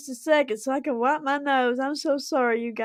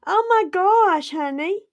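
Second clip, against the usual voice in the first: stunned; 7 semitones higher, 4 dB louder than usual. Real, not acted. Stunned by a big donation.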